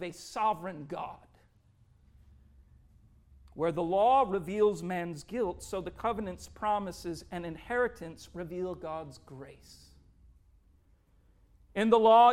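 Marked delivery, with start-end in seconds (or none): none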